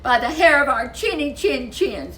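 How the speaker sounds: little wolves chanting their refusal; defiant little wolves. Acted. Wolves defying the pig.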